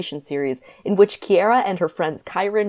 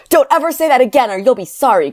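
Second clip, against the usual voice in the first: high, loud angry yell; shouted; 8 semitones higher, 7 dB louder than usual. A narrator's reading. Kyron warning Corinne, angry shout. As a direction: shout the warning at full force. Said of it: "Kyron shouted".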